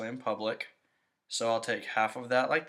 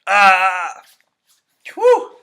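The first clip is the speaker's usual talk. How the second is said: loud yell, then a high whew; pained burning yell; 7 semitones higher, 17 dB louder than usual. Real, not acted. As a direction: cry out as the sting hits. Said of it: "my nose is on fire".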